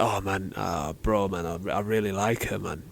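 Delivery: low, hushed confiding to a buddy; acted under-the-breath swoon, soft and low; smitten murmur. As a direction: murmur it, privately love-struck. Acting smitten man confiding in friend.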